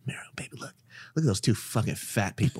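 hushed aside, opening nearly whispered; confidential, lightly disgusted undertone; retold disgust. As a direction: murmur it low to a partner in mock disgust. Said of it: "looking at them in disgust".

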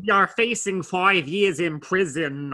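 strained, slow imitation of an effortful American accent; Eddie Redmayne. Critic mocking a British actor's American accent, strained vocal concentration. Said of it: "a very strained vocal concentration".

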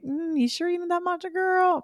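a sly, high-pitched voice of intuition questioning her; inner voice. Pretend. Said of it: "my intuition was like".

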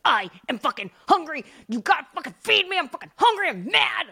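high, loud demanding dog; a starving pup yelling for food; barking dog voice. Pretend. Voicing the hungry dog barking.

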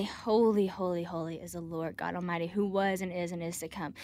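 hushed quotation of scripture; reverent. Retold or imitated. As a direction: recite it reverently and quietly. Quoting angels' worship verse.

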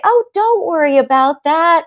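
high, loud, overeager comforting; eager reassurance. Acted. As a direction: rush in loudly to smooth the worry away. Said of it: "you're trying to reassure them".

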